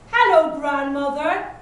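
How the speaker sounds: loud, lightly growled wolf greeting; a hint of growling wolf; wolf's growl. A narrator's reading. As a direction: call out as the wolf at the door. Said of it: "growled the wolf".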